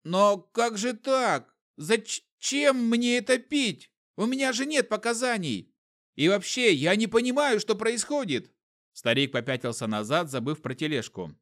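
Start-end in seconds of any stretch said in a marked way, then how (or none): 0.0-8.5 put-on much higher, slow, bewildered old man; old shopkeeper protesting at the threat; frightened old trader. A narrator's reading. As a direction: plead in confusion as a cornered old man.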